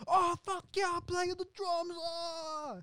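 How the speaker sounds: high, yelled rocker voice; hyped-up drummer. Acted. Drummer Frank Grillo joins in, yelling excitedly. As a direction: whoop it out high over the drums. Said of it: "Ray goes like".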